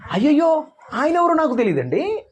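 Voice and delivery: high, panicked denial; mock-frightened high denial; Peter's denial. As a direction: act Peter fearfully denying Jesus. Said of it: "మూడు సార్లు చెప్పినాడు".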